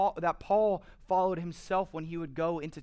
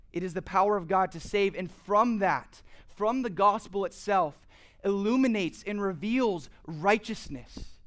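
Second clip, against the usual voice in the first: emphatic preaching; 3 semitones higher, 5 dB louder than usual. Real, not acted. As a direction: proclaim it to the congregation. Preaches with rising force.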